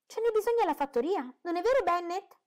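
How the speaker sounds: very high, loud coaxing; scheming mother. A narrator's reading. Wife appealing to her husband, very high and loud. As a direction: nudge your husband to back you up.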